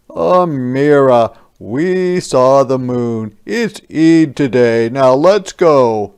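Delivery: slow, loud, settled father's answer; firm father voice. Acted. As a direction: close the matter kindly but firmly as Baba. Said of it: "answered Baba".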